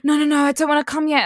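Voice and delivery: mock frantic plea. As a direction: gabble the no's in fake panic. Imitating his pleading.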